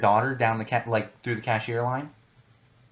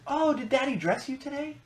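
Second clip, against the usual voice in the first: imitating a cooing older cashier, much higher; sweet cashier voice. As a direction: mimic a gushing checkout lady talking to a toddler, pitched way up. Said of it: "she says".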